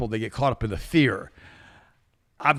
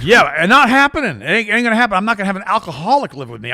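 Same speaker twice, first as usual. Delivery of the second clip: loud, high flat refusal; firm refusal; 6 semitones higher, 7 dB louder than usual. Retold or imitated. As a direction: slam the door on it. Refusing alcoholic brother firmly.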